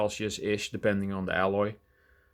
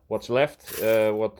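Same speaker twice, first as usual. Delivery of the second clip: normal talking voice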